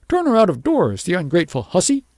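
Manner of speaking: high, loud furious outburst; shrill wife demanding the girl be thrown out; angry cry. A narrator's reading. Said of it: "cried his wife".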